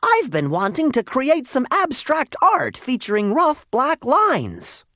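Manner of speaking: put-on very high, loud excited boy; thrilled young artist crying out. A narrator's reading. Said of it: "Jasper cried".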